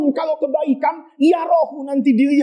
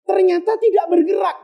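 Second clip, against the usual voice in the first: dramatic exclaim; 6 semitones higher than usual. Acted. Dramatic reveal in story.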